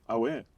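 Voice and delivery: "Aware." said short and casual as a reply; saying aware. Shown as a demonstration. Teaching the host the word, casual delivery. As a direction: drop "Aware." as an easy affirmation. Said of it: "Then you go".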